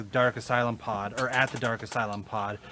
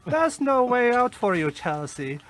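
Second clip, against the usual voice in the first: Tommy Wiseau voice; 7 semitones higher than usual. Acted. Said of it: "Hellraiser quotes to say in Tommy Wiseau's voice".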